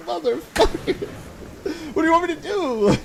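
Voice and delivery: exasperated outburst. Retold or imitated. Exasperated retort to coworker.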